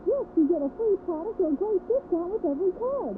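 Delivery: high, bright hype pitch; booming prize announcer. Acted. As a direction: sell the prize like a game show host. Prize offer in radio ad.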